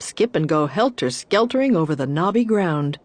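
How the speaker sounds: plain reading voice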